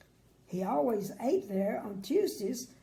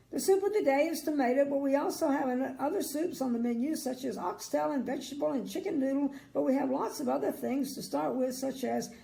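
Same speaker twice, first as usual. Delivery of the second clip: rattling on quickly, a touch higher; fast chatter; 4 semitones higher, faster than usual. Acted. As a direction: chatter on without a breath.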